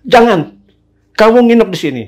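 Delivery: high-pitched, urgent order; insistent. Retold or imitated. Neighbourhood head telling the guest to stay, imitated insistently.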